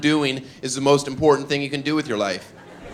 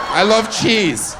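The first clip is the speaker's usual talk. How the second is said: slow, weird; 7 semitones higher, 4 dB louder, much slower than usual. Pretend. Dream weirdness, drawn out.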